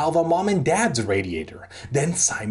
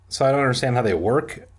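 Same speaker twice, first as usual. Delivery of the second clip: plain reading voice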